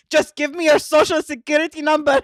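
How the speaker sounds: loud, exasperated mock demand; mad scammer. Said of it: "He's, like, so mad".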